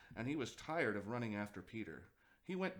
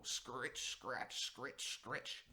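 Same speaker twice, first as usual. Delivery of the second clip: soft, softer imitated scratching of a hoe; hoe scratching noise; 4 dB quieter, much slower than usual. A narrator's reading. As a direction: scrape the sounds out like a hoe in soil. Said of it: "the noise of a hoe".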